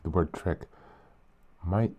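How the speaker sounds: ordinary delivery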